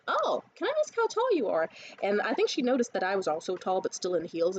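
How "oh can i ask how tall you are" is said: high, sweet, put-on flirting voice; sweet flirty ask. Retelling chatting up a tall woman, high sweet voice. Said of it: "I talked to her and I was like".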